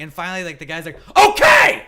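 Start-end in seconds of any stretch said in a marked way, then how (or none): 1.2-1.8 sudden high, very loud shout; exasperated full-force retort; yelled back. Retold or imitated. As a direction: blast it back at someone who will not stop yelling. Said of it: "yelled back at her".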